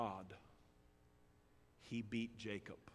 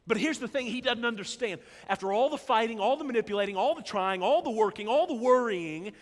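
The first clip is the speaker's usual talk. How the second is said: loud, rising preacher's build; preaching at full force; 10 semitones higher, 11 dB louder than usual. Acted. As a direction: drive it home to the congregation. Preacher's closing climax.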